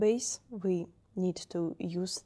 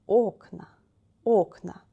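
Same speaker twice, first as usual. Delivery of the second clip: Окна with a loud, stressed first о; 8 dB louder than usual. Shown as a demonstration. Teacher drills the plural, first-vowel stress. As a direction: hit OK in OKna, drop on на. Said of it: "The first vowel is stressed".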